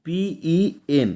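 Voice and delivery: পিইএন letter by letter, very slowly; three separate letters in পিইএন, drawn out; spelled out. Shown as a demonstration. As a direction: spell পিইএন with long gaps between letters. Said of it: "যদি আমরা বলা হয় পেন".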